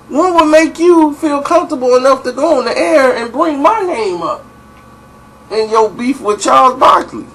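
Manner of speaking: how dare you, half laughing; indignant Mike. Retold or imitated. Voicing Mike's indignant question.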